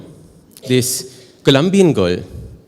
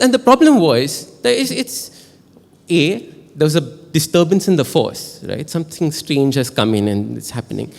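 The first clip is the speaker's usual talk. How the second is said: ordinary talk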